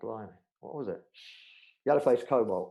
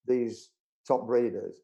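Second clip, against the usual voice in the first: normal talking voice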